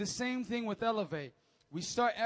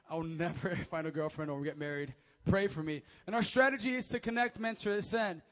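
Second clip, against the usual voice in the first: normal talking voice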